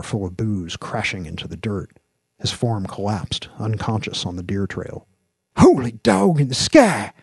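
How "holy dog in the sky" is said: high, loud cry of shock; astonished exclamation. A narrator's reading. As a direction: exclaim at what your partner just did. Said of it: "exclaimed Tram".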